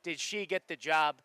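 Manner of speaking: usual speaking voice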